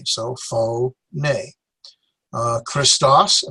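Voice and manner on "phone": "phone" pulled apart as fo... nay; "phone" as two lone syllables. Shown as a demonstration. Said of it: "syllables are separated by vowels".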